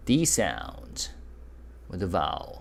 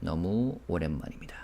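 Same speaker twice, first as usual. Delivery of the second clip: quieter, trailing repeat of the phrase; soft repeat; 3 semitones lower, 6 dB quieter, much faster than usual. Shown as a demonstration. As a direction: say it again softly to yourself. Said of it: "And then he said".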